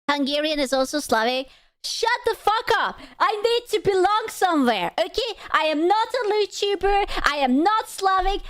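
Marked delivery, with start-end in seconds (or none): 1.8-8.4 higher, hammed-up indignant protest; a hammed-up desperate rant; mock passionate outburst. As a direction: play at being outraged about belonging. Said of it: "I'm passionate".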